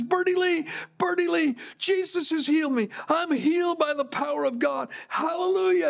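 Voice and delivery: high-pitched, exultant mock holler; retold shouting. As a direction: imitate a healed man calling out to his wife. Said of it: "he starts shouting again".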